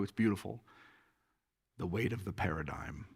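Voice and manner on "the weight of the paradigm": low, soft stab at a Scottish brogue; attempted Scottish accent. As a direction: quote him in a faint Scottish accent. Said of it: "with a Scottish accent, which I can't do".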